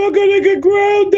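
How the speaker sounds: high cartoon wail; panicky cartoon impression. Cartoon kid dreading being grounded, high panicky voice.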